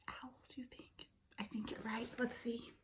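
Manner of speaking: very soft whispered suspense; teacher whispers before turning the page. Real, not acted. Building suspense before page turn.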